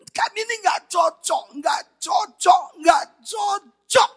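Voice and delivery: high, hammered complaint, slowing each time; mock a couple insisting they don't match; keluhan ditirukan. Mimics couples' repeated complaint. (menirukan keluhan, tinggi, makin diulur dan ditekan)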